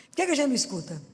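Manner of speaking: normal talking voice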